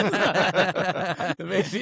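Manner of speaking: usual speaking voice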